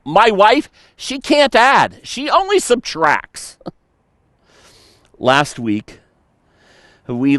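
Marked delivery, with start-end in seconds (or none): 0.0-3.6 high, whiny griping about the wife; hammed-up grumble about the wife; mock complaint. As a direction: whine it like a put-upon husband.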